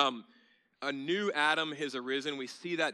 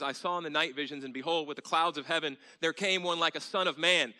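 rising, louder solemn reading of Daniel; scripture reading; 4 semitones higher, 6 dB louder than usual. Acted. Preacher to congregation, building solemn scripture reading. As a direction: proclaim the prophecy with growing force.

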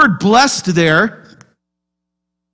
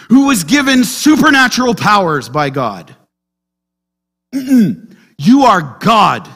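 preaching at full force; slower than usual. Acted. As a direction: proclaim Jesus as God to the congregation.

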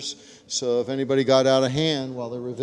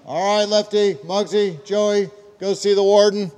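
loud, higher-pitched roll call of inmates; guard calling out; 7 semitones higher, 7 dB louder than usual. Acted.

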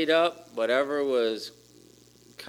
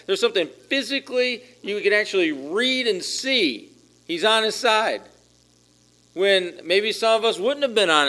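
raised, fervent preaching voice; passionate preaching; 7 semitones higher, 6 dB louder than usual. Acted. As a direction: preach it with rising fervor. Impassioned preaching at high pitch.

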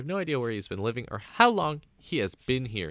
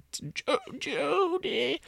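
drawn-out high name; much slower than usual. Pretend. Kid names the turtle.

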